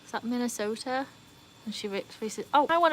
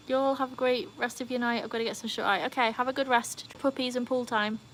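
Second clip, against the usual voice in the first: ordinary talk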